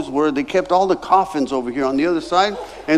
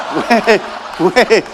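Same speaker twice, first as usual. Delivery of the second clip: loud laughing wait wait, pushed back; laughing protest; 4 dB louder, much slower than usual. Retold or imitated. Protesting worship leader assignment.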